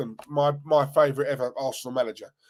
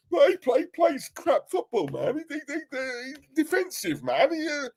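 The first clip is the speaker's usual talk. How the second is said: mock fan complaint; 9 semitones higher than usual. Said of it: "a lot of these Arsenal fans will say".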